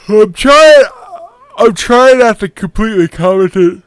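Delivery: words stretched through a big yawn. Real, not acted. To viewers while watching the match, yawning mid-sentence. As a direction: talk straight through a yawn. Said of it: "Not the story of me yawning".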